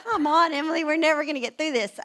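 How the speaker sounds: high, loud mock pleading; exasperated plea. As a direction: hurry her along in hammed-up frustration. Exasperated plea to crying co-host.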